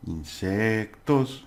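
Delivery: "Insectos" slowly, with "sec" drawn out; stressed syllable. Shown as a demonstration. Teacher shows learners where stress falls, syllable drawn out. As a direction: stretch the middle syllable of "Insectos". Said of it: "the pronunciation is stress, where you do it".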